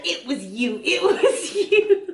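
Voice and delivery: imitated accusation rising high and drawn out; laughingly pin the blame, stretching the last words; mock accusing. Retold accusation after a jump.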